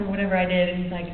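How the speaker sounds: ordinary talk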